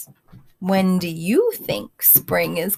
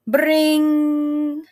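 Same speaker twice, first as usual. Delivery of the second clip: a higher playful "bring" like a clock going off; a fun alarm noise for the kids; imitated alarm ring; 7 semitones higher than usual.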